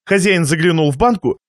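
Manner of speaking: ordinary delivery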